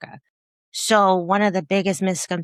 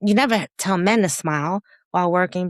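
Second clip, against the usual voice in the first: usual speaking voice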